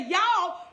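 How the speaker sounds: y'all called out loud and falling; emphatic y'all. Shown as a demonstration. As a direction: punch out y'all like a preacher. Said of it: "I'ma say it one more time".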